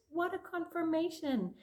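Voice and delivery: excited exclamation; thrilled. Real, not acted. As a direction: gasp it with joy. Excited by the card.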